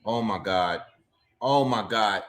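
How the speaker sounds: normal talking voice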